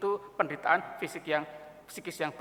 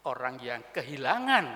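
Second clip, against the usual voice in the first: usual speaking voice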